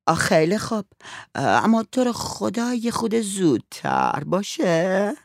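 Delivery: put-on high, slow pleading girl's voice. A narrator's reading. Girl urges the father to come back soon, high and drawn out. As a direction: beg him to hurry back, drawn out.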